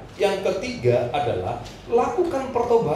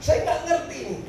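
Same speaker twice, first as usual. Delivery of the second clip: high, comic plea of not knowing; mock helplessness; 7 semitones higher, 3 dB quieter than usual. Preacher retells telling his pastor he is lost, mock helplessness. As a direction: play up the bewilderment for laughs.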